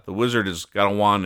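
usual speaking voice

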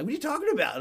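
high-pitched indignant protest, retold; mock a huffy outburst; huffy and puffy. Young employee defending [name] to bartender, huffy. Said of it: "getting all huffy and puffy".

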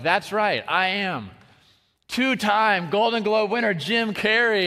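mock-grand boast, lightly acted; Jim Carrey. Comedian basking in his introduction on stage, mock-grand. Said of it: "he's like".

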